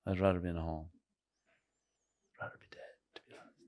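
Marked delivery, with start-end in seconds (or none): 2.4-3.5 barely audible whisper; whispered under the breath. Real, not acted. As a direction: drop to a hushed aside, no voice. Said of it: "You don't have to whisper".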